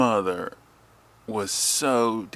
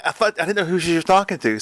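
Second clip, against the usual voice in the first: ordinary delivery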